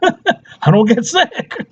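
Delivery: high, slow boast through laughter; laughing boast. Real, not acted. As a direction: laugh while drawing out the line.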